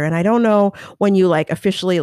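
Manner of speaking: ordinary talk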